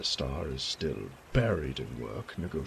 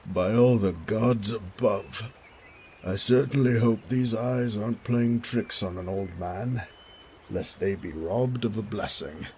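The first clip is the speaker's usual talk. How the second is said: put-on the elderly father's louder, awed welcome; overjoyed old father; 3 semitones higher, 6 dB louder than usual. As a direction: praise the gods, near tears of joy. Father greets returned son.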